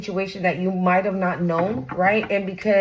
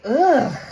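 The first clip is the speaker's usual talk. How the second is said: loud, higher groan of disgust; disgusted ugh; 4 semitones higher, 4 dB louder than usual. Acted. Acts out instant disgusted reaction.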